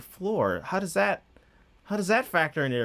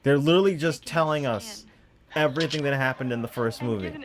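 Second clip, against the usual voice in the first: usual speaking voice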